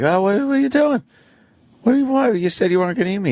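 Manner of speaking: very high squealed protest of a tiny animal; high-pitched startled critter voice, dropping slightly at the end; panicked little mammal. Acted. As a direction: play a small creature in alarm, pitch way up. Voicing frightened little mammal in fable.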